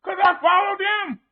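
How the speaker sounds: high, loud mock panic; acted alarm. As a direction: cry out in dismayed realization. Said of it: "what are you gonna think?".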